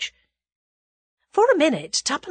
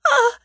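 high, loud moan of despair; anguished high "Ah"; 8 dB louder than usual. A narrator's reading. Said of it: "one last despairing moan".

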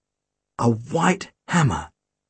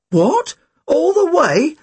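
cried out; 12 semitones higher, much slower than usual. Acted. Tim to his friend, crying out at having to run. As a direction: cry out in disbelief. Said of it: "Tim cried".

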